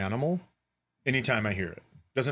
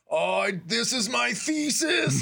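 high, slow awestruck movie line; movie character; 10 semitones higher, slower than usual. Retold or imitated. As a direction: quote it slowly in wonder. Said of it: "and he goes".

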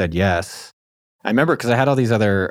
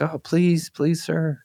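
higher, small pleading voice; mock begging; 7 semitones higher, much slower than usual. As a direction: plead like a meek supplicant, half-joking. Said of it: "I was, like, begging you".

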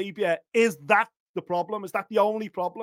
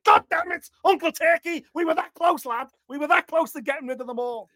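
mock frustrated yell; 6 semitones higher than usual. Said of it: "I was like".

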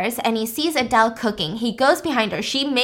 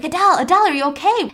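higher, louder frantic calling of her name; mock frantic shout through a window; panicked calling; 5 semitones higher, 4 dB louder, faster than usual. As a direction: bang on the glass and call her name in a panic. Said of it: "banging on the window like".

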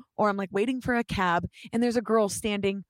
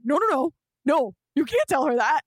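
loud, raised, urgent protest; panicked refusal; 5 semitones higher, 5 dB louder than usual. Retold or imitated. Club-goer stopping a friend from telling, panicked. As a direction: shut it down in a mock panic.

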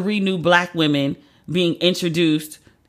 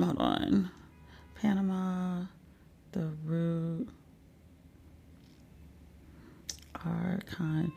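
very soft, absent-minded murmur; quiet thinking aloud; murmuring to self; 15 dB quieter, much slower than usual. Real, not acted. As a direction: mumble search words under your breath. Murmuring to self while looking up article.

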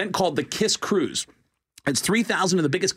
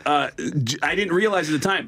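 normal talking voice

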